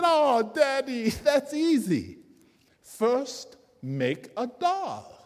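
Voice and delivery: put-on high, eager little girl; a child proudly telling her dad the answer; bright high child voice with a slow final word; daughter's voice. A narrator's reading. Narrator voices daughter's idea.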